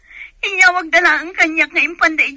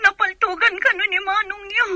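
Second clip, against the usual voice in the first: tearful news; 4 semitones higher, 3 dB quieter than usual. Acted. Telling that brother was shot.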